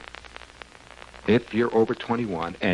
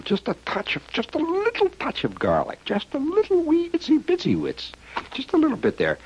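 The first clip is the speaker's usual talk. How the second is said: tiny high voice. Pretend. Tiny pinch of garlic.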